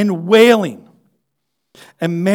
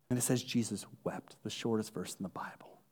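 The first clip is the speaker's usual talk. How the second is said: much softer, lower reading; hushed; 10 semitones lower, 20 dB quieter than usual. Acted. Preacher quietly tells the congregation the shortest verse, hushed. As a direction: drop almost to a murmur. Sudden hush after climax.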